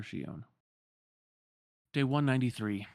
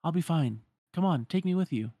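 put-on high, louder girlish voice, insisting; insistent Shion; 6 semitones higher, 5 dB louder than usual. Shion insisting to Roxas she is fine, high and pushy. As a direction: brush off worry and push to go.

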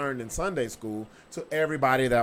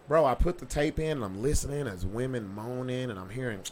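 ordinary talk, nothing marked

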